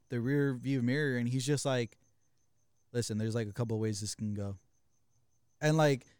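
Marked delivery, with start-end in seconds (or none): 2.9-4.6 low, softer, measured imitation of a calm friend; super calm. Driver to the shouting passenger in the back, super calm. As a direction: imitate someone laying out options in a lowered voice. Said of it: "like, super calm".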